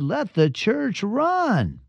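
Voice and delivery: a hint of a preacher working up the crowd; country preacher. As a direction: draw it out like a revival preacher building up. Preacher escalating his sermon.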